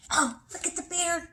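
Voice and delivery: plain reading voice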